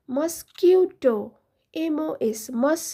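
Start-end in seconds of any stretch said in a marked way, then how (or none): 0.1-1.2 মসকিউটো said very slowly; slow model word. Shown as a demonstration. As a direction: draw out মসকিউটো syllable by syllable. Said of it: "ইংলিশে বলা হয়". (মসকিউটো ধীরে ধীরে বলা)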